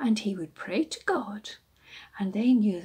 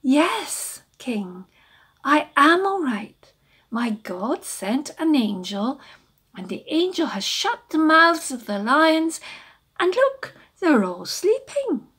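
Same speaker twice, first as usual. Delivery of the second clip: higher, louder voice of Daniel calling up; Daniel calls up to the king, higher and louder; Daniel replying; 7 semitones higher, 8 dB louder than usual. Acted. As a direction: call up from the den, cheerful. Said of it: "and he said".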